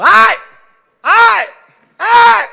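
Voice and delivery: loud, high yelps of pain; cries of pain. Shown as a demonstration. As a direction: yelp like someone stepped on your foot. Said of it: "Y tú no vas caminando diciendo".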